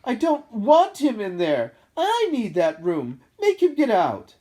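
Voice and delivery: loud, high, slow tantrum; bawling. A narrator's reading. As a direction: bawl it like a spoilt child. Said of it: "Dudley bawling at his mother".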